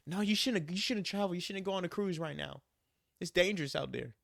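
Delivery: high-pitched mock mom warning; fretting mother. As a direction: imitate a worried mom pleading against it. Imitating mother warning against cruise.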